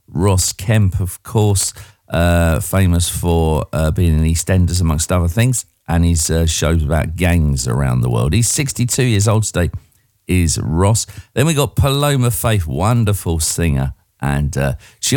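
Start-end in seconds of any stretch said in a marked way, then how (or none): none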